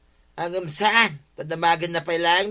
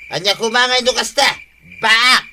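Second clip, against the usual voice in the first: loud protest; 7 semitones higher, 7 dB louder than usual. Acted. Drunk man confronted by homeowner.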